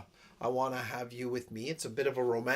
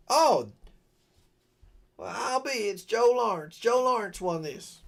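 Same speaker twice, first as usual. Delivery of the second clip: lightly voiced Richard, higher and louder; a rough try at Richard announcing the winner; impersonating Richard; 8 semitones higher, 4 dB louder than usual. Imitating Richard reading the draw winner, mildly high. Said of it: "that was a terrible impersonation".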